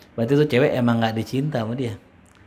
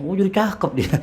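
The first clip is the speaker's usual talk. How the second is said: surprised ex-wife; 7 semitones higher than usual. Retold or imitated. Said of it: "Pas udah diceraiin".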